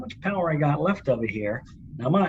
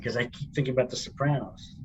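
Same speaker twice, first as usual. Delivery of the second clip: ordinary talk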